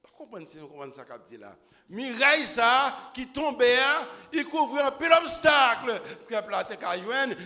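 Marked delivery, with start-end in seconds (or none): 1.9-7.3 loud, high fervent preaching; full-force preaching. Acted. Preacher driving home the fall of Jericho, fervent shout. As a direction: preach it to the rafters.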